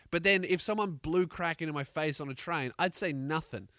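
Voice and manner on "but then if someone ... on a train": usual speaking voice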